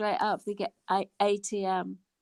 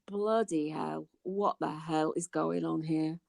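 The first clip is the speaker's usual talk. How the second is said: low, slow disbelieving aside; retold disbelief; 6 semitones lower, 4 dB quieter, slower than usual. Recalling the point where laughing stops, low drawn-out disbelief. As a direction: drop low and drag out the mock disbelief. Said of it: "there comes a point where I'm actually, I'm going".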